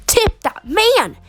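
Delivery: "Tip that man" drawn out, leaping high on MAN; high, emphatic urging. A narrator's reading. Narrator insisting you pay the barber, high emphatic repeat. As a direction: push "Tip that man" way up and stretch it.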